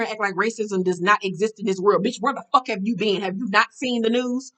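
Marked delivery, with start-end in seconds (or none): none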